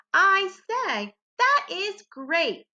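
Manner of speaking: high, slow, excited read of the sentence; excitement in my voice. Shown as a demonstration. Teacher shows the class the excitement behind the exclamation point. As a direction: sound thrilled that it is great. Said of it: "Do you hear the excitement in my voice?".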